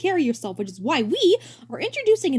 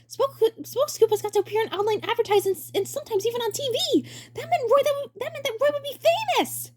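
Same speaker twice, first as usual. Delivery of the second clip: squeaky, gushing excitement; high thrilled kid voice. Acted. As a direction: bounce with glee, way up high.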